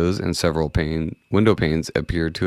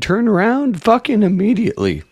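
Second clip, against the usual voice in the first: voice cracking; 13 semitones higher than usual. Real, not acted. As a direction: voice cracks up high. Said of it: "You sound like Christopher Walken".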